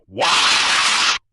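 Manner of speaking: very loud, breathy shouted wah; a planet of orcs screaming as one; massive scream. A narrator's reading. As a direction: orc horde answering their god with a scream. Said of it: "Every orc on the planet screams".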